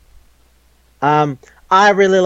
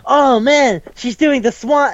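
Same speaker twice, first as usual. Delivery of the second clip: pitched way up, mock thrilled; excited gamer voice; 9 semitones higher than usual. Friends gushing over a dance move in a game, mock excited. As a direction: gush over the swan move, higher.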